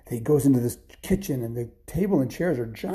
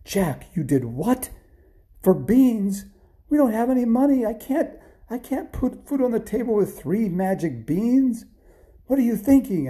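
high, loud, incredulous telling-off; mother's outraged scolding; 11 semitones higher, 6 dB louder than usual. Acted. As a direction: scold him in raised, exasperated disbelief. Angry mother scolding Jack over beans.

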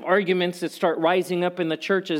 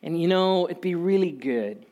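soft, lower, gushing gentle plea; overly sweet; 5 semitones lower, 3 dB quieter than usual. Pretend. Paul wrongly imagined sweet-talking the church, softer and lower. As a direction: sweet-talk them, far too gentle. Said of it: "his tone is not".

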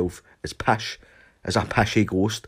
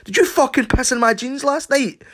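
high, loud accusing shout; angry accusation; 5 dB louder than usual. Retold or imitated. Accusing his friend Stumpy of pissing in his jeans, high and loud. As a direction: demand an answer, furious.

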